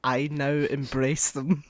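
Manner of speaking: slow, weighty mock speech; slow, grand mock declaration; mock solemn. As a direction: declaim it slowly like a proud confession.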